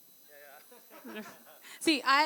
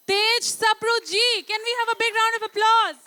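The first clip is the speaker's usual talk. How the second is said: big stage introduction; 8 semitones higher, 5 dB louder than usual. Real, not acted. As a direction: belt out the name for applause. Host announces guest, calls for applause. (ऊँची, खिंची हुई जोशीली घोषणा)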